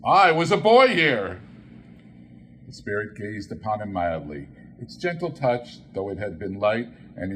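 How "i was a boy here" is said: moved exclamation. Acted. Scrooge overcome by memory.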